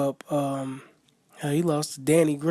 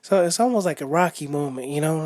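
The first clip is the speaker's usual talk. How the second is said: ordinary talk, nothing marked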